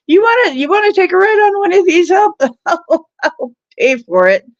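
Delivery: high, loud and fast mock invite; playful high tease. Husband on kiddie ride inviting wife, giddy high fast tease. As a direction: coax her onto the ride, giddy.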